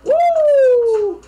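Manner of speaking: high loud whoop; excited woo. Real, not acted. Fan hyped after [name]'s verse, high loud whoop. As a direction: shout it out thrilled.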